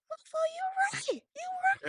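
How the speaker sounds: squeaky falsetto agreement; high-pitched mock concession. Host replaying his reaction to a comment, high hammed-up squeal. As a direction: shoot way up high, hammed-up disbelief.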